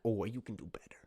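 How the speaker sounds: a frustrated athlete nudging himself on, hushed; whispered self-talk. Retold or imitated. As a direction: whisper it under your breath to yourself. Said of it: "I get frustrated at myself 'cause I'm like".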